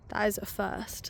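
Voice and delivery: ordinary talk, nothing marked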